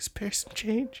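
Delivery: soft, high little beggar plea; meek mock begging, voice small and high. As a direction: plead softly for spare coins. Acting out a begging girl.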